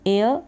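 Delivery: teacher demonstrating एयर; air pronunciation. Shown as a demonstration. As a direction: model एयर clearly. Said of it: "A I R को भी same एयर पढ़ते हैं हम".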